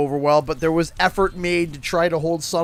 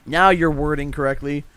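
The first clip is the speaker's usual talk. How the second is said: usual speaking voice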